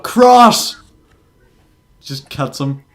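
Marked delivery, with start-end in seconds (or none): 0.0-0.8 high, loud battle yell. Acted. Game master voices a character in a fight, yelling out. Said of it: "just yells out".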